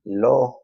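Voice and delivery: lo said high and loud; stressed lo. Shown as a demonstration. Teacher stressing the pronoun lo. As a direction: pop the word lo out clearly. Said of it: "we're gonna use the".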